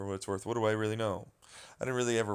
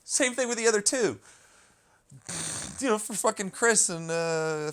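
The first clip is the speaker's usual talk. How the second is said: loud, higher, slowed fury; angry outburst; 9 semitones higher, 5 dB louder than usual. Real, not acted. Ranting at speculating fans, loud and furious. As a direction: spit it out, really fed up. Angry rant about interviewers.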